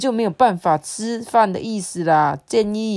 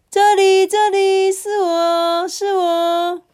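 very high, drawn-out little creature call; high cartoon-creature voice, eager; perky talking rice cooker; 13 semitones higher, 6 dB louder, much slower than usual. A narrator's reading. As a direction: call out for attention in a high squeaky voice.

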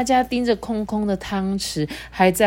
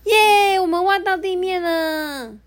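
much higher, bright cheer of triumph; high excited animal cheer; 11 semitones higher than usual. A narrator's reading. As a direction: burst out celebrating the breakthrough. Animals break through ground.

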